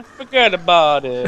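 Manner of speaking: slow, stretched mock New York Italian; Italian New York accent. Gamer clowning with a friend, drawn-out Italian New York accent. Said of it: "That's my Italian New York accent".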